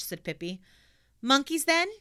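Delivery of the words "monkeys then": put-on high, loud pert girl question; cheeky little girl. Pippi voiced in story.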